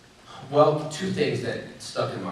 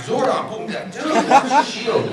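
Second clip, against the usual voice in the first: high, loud and slow, laughing through it; laughing taunt; 14 semitones higher, 9 dB louder, slower than usual. Retold or imitated. Showing off his shield to the other Rangers, laughing high and slow. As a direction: crow at the others while cracking up. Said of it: "I'm like".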